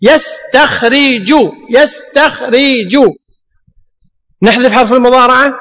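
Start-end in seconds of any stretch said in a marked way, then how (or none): 0.0-1.3 slow, spelled-out يستخرج; teacher sounding out يستخرج; slow demo. Shown as a demonstration. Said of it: "لو قال لنا قائل". 1.7-3.1 slow repeat of يستخرج; teacher sounding out يستخرج once more; slow demo. Shown as a demonstration. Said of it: "لو قال لنا قائل".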